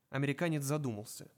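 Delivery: plain reading voice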